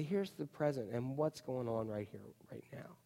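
soft hushed whisper to yourself. Shown as a demonstration. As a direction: breathe the words like a private thought. Quiet moment of reflection.

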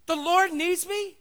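slow, high, loud astonished question; amazed disbelief. Acted. Donkey stunned that the Lord needs him, slow and high. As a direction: savour the unbelievable news.

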